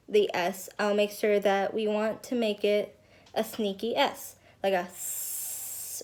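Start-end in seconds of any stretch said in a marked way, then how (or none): 4.8-6.0 long hissing s sound; drawn-out s like a snake. Shown as a demonstration. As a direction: hiss the s like a snake. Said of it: "we're gonna sound like a snake".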